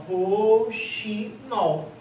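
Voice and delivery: rouxinol very slowly split as rou-xi-nol; syllable by syllable. Shown as a demonstration. Teacher giving a memory word, rouxinol slowly split. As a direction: break rouxinol into three drawn-out beats. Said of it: "você pode lembrar do rouxinol".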